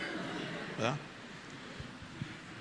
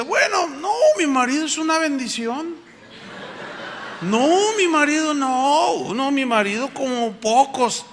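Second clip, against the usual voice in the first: wife backpedaling; 6 semitones higher than usual. Retold or imitated. Wife suddenly praises her husband.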